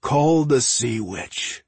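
slow, drawn-out sinister command; measured, menacing creature voice; commanding inner voice. A narrator's reading. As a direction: command slowly, like a voice inside his head. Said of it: "the voice commanded".

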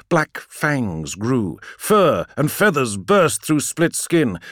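ordinary delivery

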